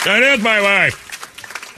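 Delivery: very slow, high-pitched mock indignation; mock outraged cry, every word drawn out; hammed-up outrage. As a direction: stretch it out like an exaggerated affronted husband. Retold husband outraged, repeated bigger.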